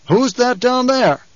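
high, drawn-out bark; barked question. A narrator's reading. Duke spotting figures on the sand, barked. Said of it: "the Duke barked".

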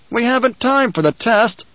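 high-pitched objection from above; protesting. A narrator's reading. Man in the rocks objects to testing, protesting. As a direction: protest urgently to your leader. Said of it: "the voice above them protested".

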